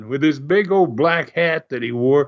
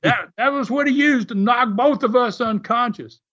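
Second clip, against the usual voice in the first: higher-pitched mock outrage; half-hearted retold scream, pitched up; 8 semitones higher than usual. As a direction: imitate an indignant yell without the volume. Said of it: "he screamed to Les".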